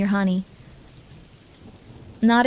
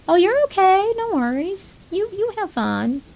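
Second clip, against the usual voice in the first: much higher, sweet reassuring voice; reassuring put-on voice; 9 semitones higher than usual. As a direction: lift way up and soothe like a kind colleague. Voicing a reassuring other.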